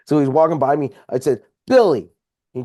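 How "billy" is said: a sharp call across the driveway, louder; calling out sharply. Retold or imitated. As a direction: shout his name to stop him. Calling rival to turn around.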